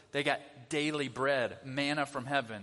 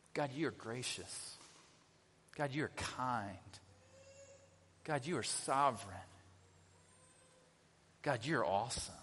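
reverent prayer; 4 semitones lower, 5 dB quieter than usual. Shown as a demonstration. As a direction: pray each line quietly and reverently. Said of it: "start your prayers with".

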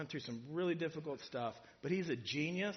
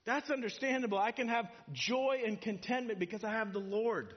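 relieved realization; 8 semitones higher, 4 dB louder than usual. Retold or imitated. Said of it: "And it's like".

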